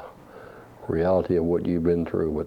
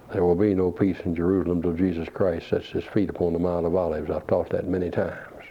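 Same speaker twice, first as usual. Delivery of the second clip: ordinary talk, nothing marked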